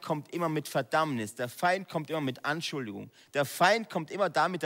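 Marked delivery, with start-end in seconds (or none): none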